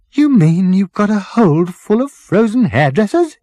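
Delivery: incredulous Arthur. Acted. Arthur questioning the captain about frozen hairdressers, incredulous. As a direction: Arthur aghast at the news. Arthur voiced, high, far.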